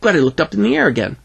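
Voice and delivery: usual speaking voice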